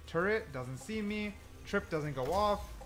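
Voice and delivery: usual speaking voice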